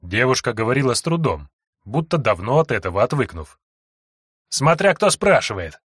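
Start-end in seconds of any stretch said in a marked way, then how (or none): 4.5-5.8 still furious. Acted. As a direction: snap back in lingering rage. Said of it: "всё ещё пребывающая в ярости Сойка". (всё ещё в ярости)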